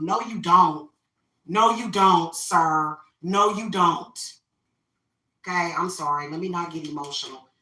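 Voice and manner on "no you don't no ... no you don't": louder, flat refusal, each word final; firm put-down. Acted. Vlogger rebuffing men who act like rulers, louder and firm. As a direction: shut him down, no room to argue.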